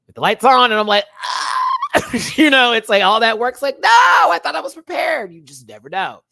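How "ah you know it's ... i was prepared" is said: high, loud, laughing panic; mock panic. Contestant freezing under the red light, high loud laughing panic. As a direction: act out freezing on camera.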